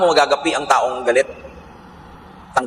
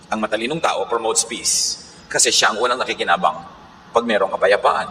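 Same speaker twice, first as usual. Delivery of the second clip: normal talking voice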